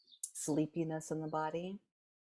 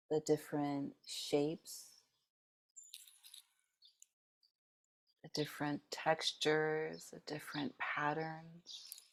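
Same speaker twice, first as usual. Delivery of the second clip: soft, unhurried meditation guide, long pauses between phrases; guided meditation; 5 dB quieter than usual. Real, not acted. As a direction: gently lead the group through noticing, leaving space after each phrase.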